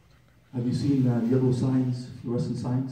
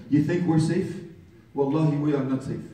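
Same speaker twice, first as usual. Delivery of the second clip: ordinary delivery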